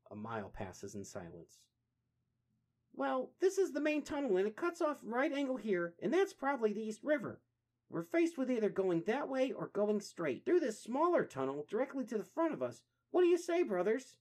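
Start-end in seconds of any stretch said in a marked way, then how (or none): 2.9-14.1 high, loud, brisk explaining; eager turtle voice. Acted. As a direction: lay out the route with energy. Donnie explaining tunnels.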